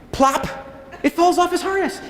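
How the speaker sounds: usual speaking voice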